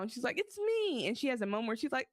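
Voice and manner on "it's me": put-on much higher, childlike plea; a young daughter announcing herself; high small voice; high girl voice. Daughter pleading in retold scene.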